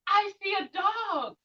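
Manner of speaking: gasp, then slow lifted reveal; surprised gasp. Shown as a demonstration. Teacher pretending to spot a dog, gasping in surprise. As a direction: act amazed to spot a dog.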